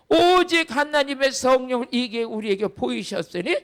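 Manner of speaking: loud, steady recitation of the verse; loud scripture reading. Acted. Pastor leading the congregation through the verse, loud. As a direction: read it out together, full voice.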